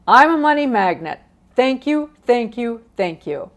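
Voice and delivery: exuberant affirmation. Shown as a demonstration. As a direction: proclaim it with delight, then ease down on the last thanks. Demonstrates her exuberant money mantra.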